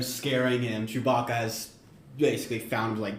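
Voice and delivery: ordinary talk, nothing marked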